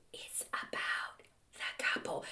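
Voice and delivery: a breathy unvoiced aside; stage whisper. Real, not acted. To viewers about wedding toasts, whispered aside. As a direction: whisper it like a secret, no voice.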